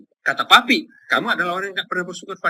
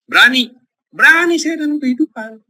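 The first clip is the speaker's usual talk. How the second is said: loud, high call of courage; emphatic proclaiming; 5 semitones higher, 5 dB louder than usual. Real, not acted. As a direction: proclaim it boldly to the congregation. (seruan lantang penuh semangat)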